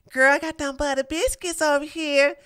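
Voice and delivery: ordinary delivery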